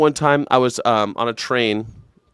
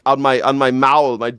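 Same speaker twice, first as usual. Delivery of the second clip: ordinary talk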